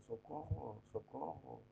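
mock American accent on a drowning cry, soft; gringo accent. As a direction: feeble gringo cry for help, r mispronounced. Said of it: "Un gringo se está ahogando y dice".

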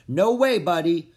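loud, sharp scold to a pet; loud scolding no way buddy. A narrator's reading. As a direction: tell the critter off, high and loud. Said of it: "I scolded Sassafras".

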